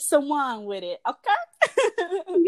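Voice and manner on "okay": high sing-song okay; playful high okay. Real, not acted. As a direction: toss it up playfully. Playful sign-off question.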